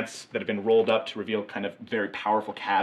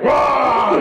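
high, loud laughing whoop; cheering orc crew yelling for Cordelia; crowd cheer yell; 13 dB louder than usual. Acted.

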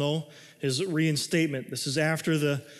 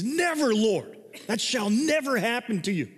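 sharp, much higher cry of protest; rebuking protest; 7 semitones higher than usual. Acted. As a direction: push back hard on him, shocked. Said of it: "began to rebuke him".

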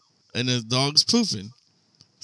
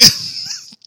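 high laugh burst. Real, not acted. Laughing at the trick.

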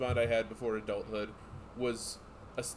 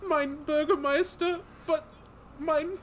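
mock German accent; 13 semitones higher, 6 dB louder than usual. Mouse pleading with the Burgermeister in a high mock German accent.

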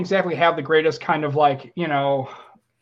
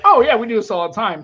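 higher, louder, airy brush-off; breezy dismissal; 6 semitones higher, 5 dB louder than usual. Retold or imitated. Husband reassuring people who fear divorce, breezily. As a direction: wave the worry away, voice lifted. Airily reassures worried onlookers.

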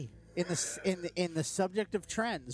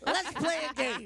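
Chris Rock voice; 9 semitones higher than usual. Retold or imitated. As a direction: yell it like a stand-up comic. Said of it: "It's Chris Rock's voice instead".

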